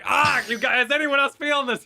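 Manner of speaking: high, loud mock alarm; mock alarmed whine. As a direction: squeal it like a startled sidekick. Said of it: "B plot's off in another room like".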